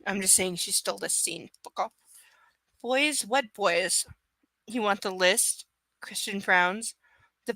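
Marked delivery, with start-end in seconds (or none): none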